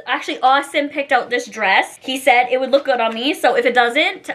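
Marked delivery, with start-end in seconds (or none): none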